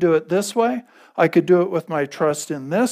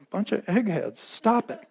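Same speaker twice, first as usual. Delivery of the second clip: softer, tossed-off jab, mock gruff; a playful, softer mock scold; softer mock aside; 3 semitones higher, 6 dB quieter than usual. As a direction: mutter the jab half to yourself, teasing. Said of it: "sweet pastoral part of me says".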